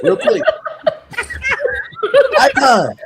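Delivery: high giggling question; laughing. Real, not acted. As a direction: laugh while asking.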